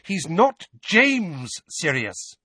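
high, slow, weighted words; emphatic motherly reproach; pointed reproach. A narrator's reading. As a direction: spell it out slowly, pitched high. Mother warns Sirius heatedly.

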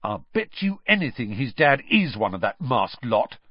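usual speaking voice

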